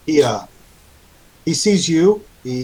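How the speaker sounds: ordinary talk, nothing marked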